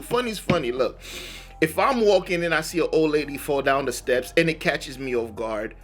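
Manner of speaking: normal talking voice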